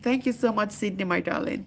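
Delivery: usual speaking voice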